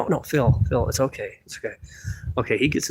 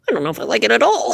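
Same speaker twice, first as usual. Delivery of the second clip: much higher, fast puppet doubt; 9 semitones higher, faster than usual. Acted. Puppet unsure about comment.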